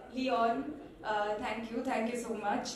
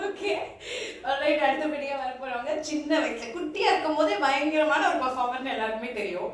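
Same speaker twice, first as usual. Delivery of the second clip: loud, fast, high emcee hype over the mic; stage announcer; 4 semitones higher, 9 dB louder, much faster than usual. Acted. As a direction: pump up the crowd for the next act. Emcee announces next performer. (உரத்த மேடை அறிவிப்பாளர் குரல்)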